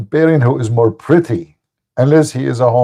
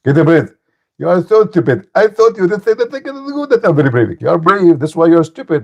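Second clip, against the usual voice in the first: higher mock preacher voice; imitating Zakir Naik; 6 semitones higher than usual. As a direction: ham up the opponent's lecturing. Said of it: "Zakir Naik".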